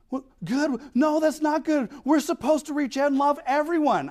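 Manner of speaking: high-pitched scandalized protest; mock shock. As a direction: imitate an appalled woman objecting. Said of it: "She, she gasps".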